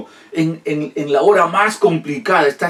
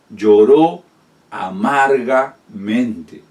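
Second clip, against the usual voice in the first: drawn-out emphasis; 2 semitones lower, 3 dB louder, much slower than usual. Shown as a demonstration. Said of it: "Esta palabra en griego significa".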